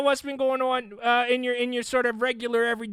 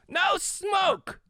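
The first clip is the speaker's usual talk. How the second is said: sharply higher, shouted no smoke; mock incredulous shout, much higher; mock disbelief shout; 10 semitones higher than usual. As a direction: call out in playful disbelief. Joking shout at a big claim.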